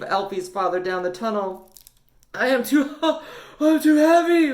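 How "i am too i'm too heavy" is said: wheezing. Acted. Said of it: "wheezed Dad".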